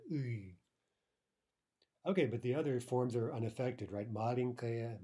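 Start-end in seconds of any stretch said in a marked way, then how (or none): 0.1-0.5 "U" as a drawn-out backed ы sound; wrong ы vowel. Shown as a demonstration. Teacher showing the sound to avoid, "U" as hard ы. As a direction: say "U" like Russian ый, not ee. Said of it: "not U".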